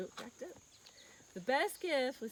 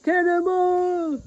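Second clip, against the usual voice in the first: long, loud drawn-out yell, higher; cannonball yell; 7 semitones higher, 15 dB louder, much slower than usual. Real, not acted. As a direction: shout it as you jump. Leaping into the water.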